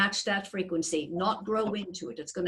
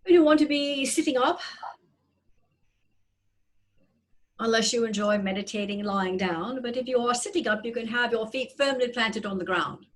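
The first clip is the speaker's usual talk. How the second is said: guided meditation. Acted. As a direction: gently guide their breathing. Leads a breathing meditation.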